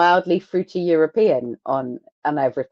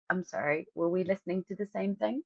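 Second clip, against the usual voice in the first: much softer, understated incredulity; quiet disbelief; 3 semitones higher, 13 dB quieter than usual. Retold or imitated. Viewer doubting the band's praise, quietly incredulous. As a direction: drop right down for a baffled aside.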